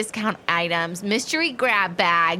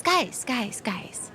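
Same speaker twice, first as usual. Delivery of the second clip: fussy, fading call for attention; a prim kid calling the group to order; Peach character voice; 2 semitones higher, 5 dB quieter, much slower than usual. Acted. Peach calling her friends to stop, prim voice. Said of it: "I'm using a character voice as well".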